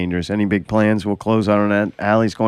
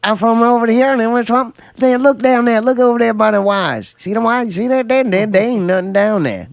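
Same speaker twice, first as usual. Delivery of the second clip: much higher, insistent odd-guy voice repeating himself; weird put-on voice; 11 semitones higher than usual. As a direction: imitate a drunk buddy pushing the same line over and over. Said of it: "He's obviously doing a voice".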